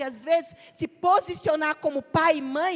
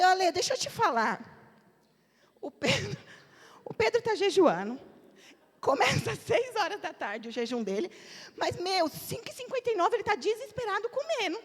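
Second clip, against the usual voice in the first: indignant laughing; 5 semitones higher than usual. Retold or imitated. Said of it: "eu fiquei tão indignada".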